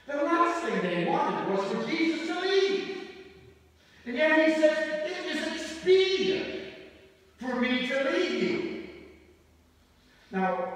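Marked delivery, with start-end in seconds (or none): 0.1-8.9 loud, high-pitched sermon delivery; raised, emphatic pulpit voice; full-force preaching. Real, not acted. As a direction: preach it out with rising intensity.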